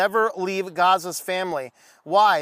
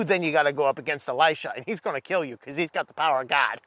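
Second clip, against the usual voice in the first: ominous game boss; 6 semitones lower, faster than usual. Acted. Video game boss hype, low ominous.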